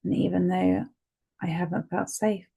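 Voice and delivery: steady, soothing guide through each tapping point; guided tapping session. Acted. Therapist guiding a listener through tapping, calmly.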